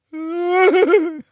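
loud, high wail; one long mock sob, high and loud; imitated crying. Shown as a demonstration. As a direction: mimic a passing cyclist's bawl. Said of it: "Maybe they just heard, like".

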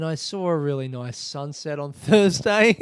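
words breaking into a giggle; laughing. Real, not acted. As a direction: laugh through the end of it. Laughing mid-sentence.

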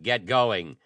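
loud, high sharp snap; a loud angry snap at Dick; snapped. Acted. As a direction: bite the words off at him. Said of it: "he snapped".

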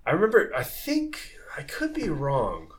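unsure whisper to himself; whispered aside. Real, not acted. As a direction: drop to a whisper, doubting yourself.

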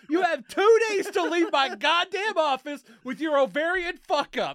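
high, very loud comic tirade; mock furious yell. Doctor throwing out the patient with two days, mock furious yelling. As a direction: scream them out of the office.

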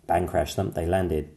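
plain reading voice

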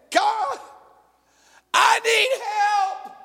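high, slow, whiny plea for help; high pleading whine with a laugh; pleading Moses. Acted. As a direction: beg God like a helpless child. Moses crying out, voiced.

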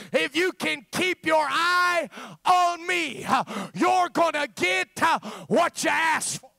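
high, loud impassioned sermon cry; fervent shouted preaching, slowing on key words; full-force preaching. Real, not acted. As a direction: preach it out to the back row, pleading.

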